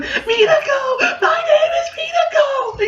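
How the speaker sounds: very high, drawn-out mock wail; hammed-up falsetto shriek; panicked cry. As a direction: squeal it out slowly like a terrified victim. Acting out a cornered character's reply.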